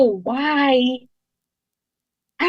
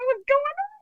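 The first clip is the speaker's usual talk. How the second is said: puzzled "what's going on"; 13 semitones higher, much faster than usual. Retold or imitated. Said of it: "I'm like".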